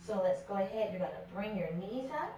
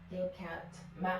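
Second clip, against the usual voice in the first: normal talking voice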